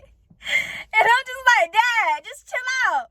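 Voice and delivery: high, slow, crying plea; tearful. Real, not acted. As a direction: plead with Dad through tears. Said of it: "it brings me to tears".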